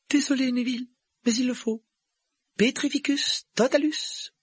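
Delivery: put-on very high girl's voice; apologise, then pronounce the spell firmly; high-pitched young witch; apologetic spellcaster. A narrator's reading. Hermione apologises, then casts spell.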